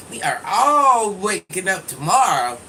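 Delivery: loud rallying chant, stressing all; emphatic call-out. Shown as a demonstration. Rallying her viewers, she calls out loudly. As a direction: shout it so everyone joins in.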